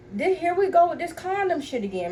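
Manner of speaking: high-pitched, fed-up complaint; exasperated. Retold or imitated. Acted quarrel, exasperated quote.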